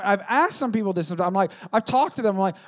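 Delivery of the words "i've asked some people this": normal talking voice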